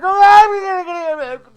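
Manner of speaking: high, full-volume panicked cry; loud, wailing re-enacted alarm; retold frantic screaming. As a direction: scream it in acted hysteria. Re-enacting the panic after injury.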